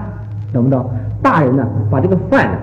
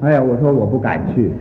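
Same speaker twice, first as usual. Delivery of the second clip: ordinary talk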